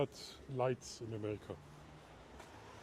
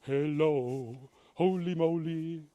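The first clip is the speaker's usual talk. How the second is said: louder, hammed-up greeting called out to a friend; loud playful hello; 2 semitones higher, 7 dB louder, slower than usual. Greeting Steve in America on the livestream, loud and playful. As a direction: belt out a cheery hello like a showman.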